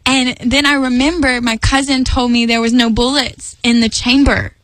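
ordinary talk

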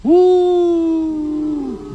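high hooting woo like wind; sermon sound effect wind howl. Shown as a demonstration. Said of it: "Everybody go over here, go".

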